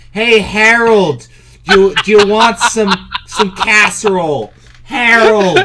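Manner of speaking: put-on Yiddish-accented wife. Joking on stream, calling husband in mock Yiddish accent.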